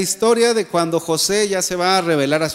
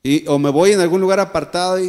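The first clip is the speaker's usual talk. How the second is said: ordinary talk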